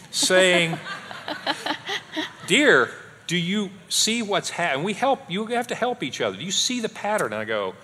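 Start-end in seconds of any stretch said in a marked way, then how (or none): 0.1-2.5 breaks into a laugh; laughing mid-sentence. Real, not acted. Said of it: "kinda sounded like you". 2.5-7.3 higher, gently pointed wife's voice; imitating his wife. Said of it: "kinda sounded like you".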